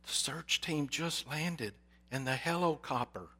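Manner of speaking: put-on soft, hushed child in awe; awed whisper. A narrator's reading. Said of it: "In an awed, whispering voice".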